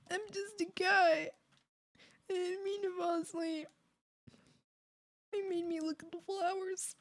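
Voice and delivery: small, high, soft voice; pitiful pleading. Acted. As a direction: plead meekly in a high little voice. Pleading as the shot character.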